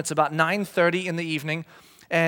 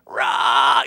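loud breathy retching roar; mock vomiting roar; 4 semitones lower, 7 dB louder than usual. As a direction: blast out a kid's throw-up noise. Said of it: "just kinda stand there and just go".

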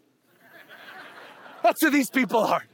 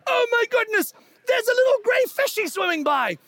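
excited gasp; 11 semitones higher than usual. Retold or imitated. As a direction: gush like a first-time snorkeler.

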